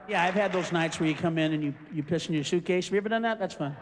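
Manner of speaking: usual speaking voice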